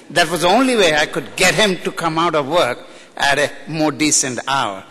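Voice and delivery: ordinary talk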